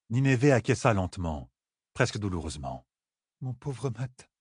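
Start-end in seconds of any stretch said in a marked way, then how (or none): 3.4-4.3 soft sad murmur; barely audible lament. Acted. Nineveh murmuring about Matt, soft. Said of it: "crut l'entendre murmurer".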